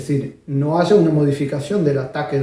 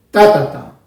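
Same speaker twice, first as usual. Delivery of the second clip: Ta, ta, ta with the first Ta punched loud and the rest held; accent then sustain; 8 dB louder than usual. Shown as a demonstration. Teacher showing the accented then sustained rhythm, loud. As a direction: strike the first ta, sustain after. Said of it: "hay un acento y después se sostiene".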